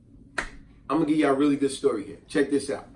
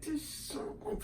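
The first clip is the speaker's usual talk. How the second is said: whispered, bitten-off curse; cut-off whispered curse; 10 semitones higher, 16 dB quieter than usual. Retold or imitated. Narrator swallows a curse at the rude clerk, whispered. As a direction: curse under your breath and stop short. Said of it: "I was like".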